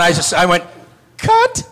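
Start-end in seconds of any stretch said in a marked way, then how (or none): 1.2-1.7 much higher, loud call; yelling cut. Retold or imitated. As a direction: shout it across the set.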